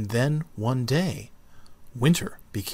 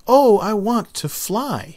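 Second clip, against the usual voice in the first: high, slow and eager little seed; a tiny seed dreaming out loud with a grin; wide-eyed child seed, grinning; 9 semitones higher, 6 dB louder than usual. Acted. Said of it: "he said with a grin".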